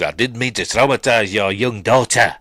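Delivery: imitated South African accent. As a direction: put on a South African villain's apology. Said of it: "his South African accent".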